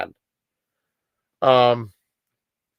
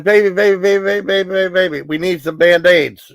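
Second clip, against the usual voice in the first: put-on high, insistent, repeating baby; begging her boyfriend to hurry; urgent pleading; 6 semitones higher than usual. Girlfriend begging her bitten boyfriend for Band-Aids, high urgent plea.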